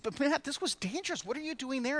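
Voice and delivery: worried parent. Retold or imitated. Parent voiced in story.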